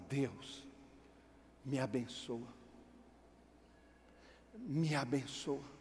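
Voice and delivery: soft, low, slow pleading; quiet plea. Acted. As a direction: beg God under your breath. Jacob pleads to God quietly.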